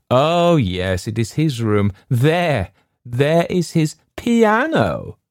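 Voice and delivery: piano drawn out high and slow with a falling end; emphasis on piano. A narrator's reading. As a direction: lean hard on the word piano, mocking. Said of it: "The peculiar emphasis they laid on the word piano".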